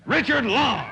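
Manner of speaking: high, loud show introduction; calling out a name. Real, not acted. Host summoning his anchor man, loud high call. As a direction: announce the next player at full volume. Host calls on the next player.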